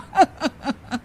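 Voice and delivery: a soft, gleeful villain's chuckle; gloating chuckle. Acted. Uncle gloats about a mother-in-law fight, with a soft chuckle. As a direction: savour the quarrel that is coming.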